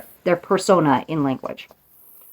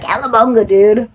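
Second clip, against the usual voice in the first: higher, louder gnarly Cowabunga dude; eighties surfer kid; 5 semitones higher, 4 dB louder than usual. Retold or imitated. Urging viewers to relive childhood sayings, surfer kid call. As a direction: shout it like a nineties kid. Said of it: "sayings that you used to say when you were a kid".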